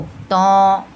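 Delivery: "तौ" held long with a rounded "o"; long, full "तौ" coloured with "o"; heavy "o" sound. Shown as a demonstration. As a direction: fill the mouth on "तौ". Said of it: "ओ की sound आते हुए हम अदा करेंगे".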